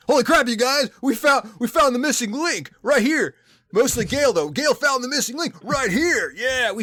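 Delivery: high, loud goofy excitement; excited. Pretend. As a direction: squeal it like a thrilled kid. Voicing Gale overexcited.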